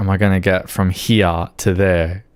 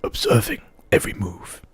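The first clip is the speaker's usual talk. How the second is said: hushed, fading wildlife narration; soft nature documentary voice; nature documentary narrator; 2 semitones higher, 4 dB quieter than usual. Acted. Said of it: "an impression very well of ... Sir David Attenborough".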